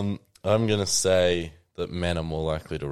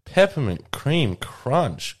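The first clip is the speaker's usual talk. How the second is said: ordinary delivery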